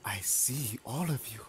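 hushed, creepy whisper; stalker's whisper. Pretend. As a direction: whisper it to your unseen prey. Killer stalking all the survivors.

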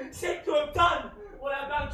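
shouted in self-disgust, high and loud; anguished shout. Acted. Faustus to his friend, shouting his self-disgust. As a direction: tear the words out in despair.

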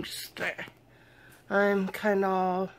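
tired slurred speech; slurring words. Real, not acted. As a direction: slur the words drowsily. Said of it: "slurring my words and stuff".

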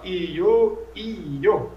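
"io" split into two vowels, i-o; io with hiatus. Shown as a demonstration. Teacher showing what Sanskrit avoids, "io" as a hiatus. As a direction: separate the i from the o in "io". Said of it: "você faz um hiato".

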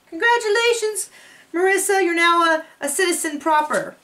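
high congratulatory voice, easing down by the end; high-pitched playful tribute; celebratory cheer. Pretend. Congratulates Marissa on her citizenship.

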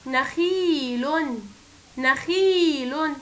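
نخيل very slow with the ee held; slow stretched reading. Shown as a demonstration. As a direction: draw out نخيل. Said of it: "كلمة".

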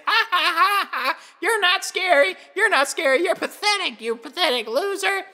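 high, mocking taunt; mocking children. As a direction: laugh and jeer like taunting kids. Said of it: "they just laugh and point and say".

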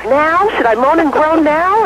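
high, rising eager question; eager asking. Acted. Wife in bed asking husband for her cue, eager. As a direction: ask keenly for the cue.

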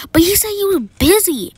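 high, loud indignant complaint; indignant protest. Acted. Girl protests being turned down.

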